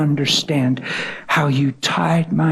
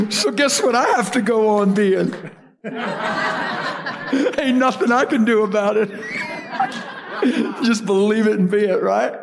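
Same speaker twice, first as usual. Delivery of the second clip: joyous cackling laugh while talking; laughing joyfully; 6 semitones higher than usual. Real, not acted. Preacher to congregation, laughing with joy. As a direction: laugh through the words, pitched up with delight.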